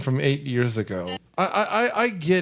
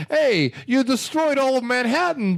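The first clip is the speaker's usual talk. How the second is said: higher, brash cartoon Brooklyn wiseguy; mock Brooklyn tough guy; 8 semitones higher than usual. Mocking the movie's Brooklyn character, brash wiseguy voice. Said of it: "him going".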